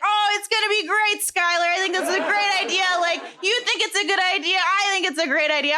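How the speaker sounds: high, quick retold gushing; eager enthusiasm. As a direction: pitch it upbeat and high, rushing along. Retelling her eager pitch to Skylar.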